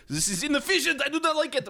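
put-on a stiff German accent, high and emphatic; German-accented Tesla. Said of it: "Why do you sound German?".

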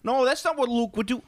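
high-pitched mock fanboy protest; complaining fans. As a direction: parody a fan's indignant objection, way up high. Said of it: "they're legit complaining".

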